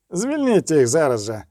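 mild, contained shout; shouted order. A narrator's reading. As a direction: cry out a command without much volume. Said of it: "закричав він".

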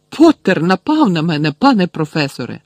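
high, loud tattling complaint; loud accusation. A narrator's reading. Malfoy blames Potter before Snape, accusing loudly. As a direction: protest to the teacher.

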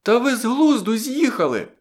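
very high, piercing outcry; high-pitched shrieked protest. A narrator's reading. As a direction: cry out shrilly in alarm. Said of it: "Блондинка пронизливо зойкнула".

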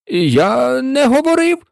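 cutting in to deny it; high, hurried protest; quick denial. A narrator's reading. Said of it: "швидко перебив".

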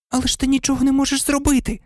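high, fast shocked cry; shocked exclamation. Acted. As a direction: blurt it out, stunned. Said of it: "вигукнула приголомшена Герміона".

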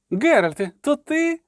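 high voice leaping up on the question; astonished. A narrator's reading. Poet spotting his witcher friend, high astonishment. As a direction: shoot up high in disbelief.

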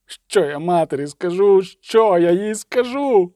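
high anguished plea, drawn out at the end; tearful wail, acted. A narrator's reading. Tearful dwarf asking what to tell mother, wailing. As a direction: build to a despairing cry. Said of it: "мокре від сліз обличчя".

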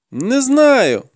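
high-pitched denial; pleading captive boy. A narrator's reading. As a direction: protest in a high boy's voice. Captive boy denies knowing more.

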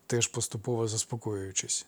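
ordinary delivery

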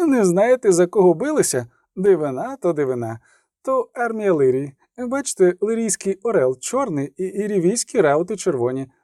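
a kindly orderly soothing his patient; chatty field medic. Acted. Medic explains and finishes treatment. (балакучий фельдшер заспокоює відьмака)

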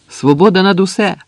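high, loud shouted slogan; ringing high-pitched call; rallying cry. A narrator's reading. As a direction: cry it out to rouse the others. Slogan called out to the trench.

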